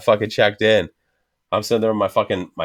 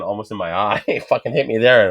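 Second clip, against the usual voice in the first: normal talking voice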